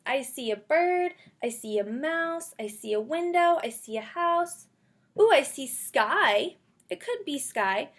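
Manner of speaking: ordinary delivery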